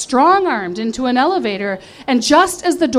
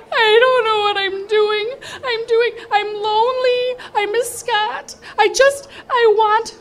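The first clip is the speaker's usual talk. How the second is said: higher, tearful whine; retold blubbering; 9 semitones higher than usual. Drunk narrator confessing to her sister, tearfully. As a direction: mildly play the drunk crying. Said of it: "I sobbed".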